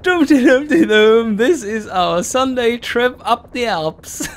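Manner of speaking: high, loud sing-song mockery with laughs; mock the dawdling pack as holiday riders; hammed-up mocking voice of lazy cyclists; mocking carefree riders. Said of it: "why I'm so fucking frustrated".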